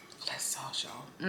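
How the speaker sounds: whispered, admiring the sauce. Real, not acted. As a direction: breathe it out in a whisper. Whispering over the food.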